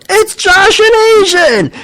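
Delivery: very high, drawn-out loud cheer; crowd cheer. Retold or imitated. Everybody in the place greeting Josh and Andy, cheering. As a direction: imitate a whole bar hollering a welcome. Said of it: "I remember everybody going".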